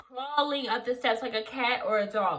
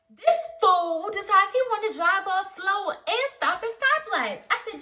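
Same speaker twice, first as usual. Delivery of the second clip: ordinary delivery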